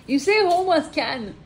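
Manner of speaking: lent, taquin et moqueur; le taquiner doucement. Real, not acted. Taquine S1 en anglais. (taquine son compagnon sur son foyer, ton moqueur)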